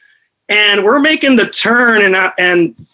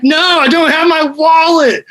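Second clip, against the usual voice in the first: panicked reply; 8 semitones higher than usual. Retold or imitated. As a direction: blurt it out in a panic. Panicked reply in retold story.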